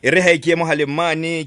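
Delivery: ordinary talk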